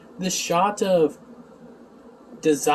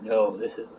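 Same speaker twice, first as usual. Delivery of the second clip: Emo; 6 semitones lower, 4 dB quieter than usual. Retold or imitated. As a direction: answer as a grave dream lord, hushed and deep. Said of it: "he's like".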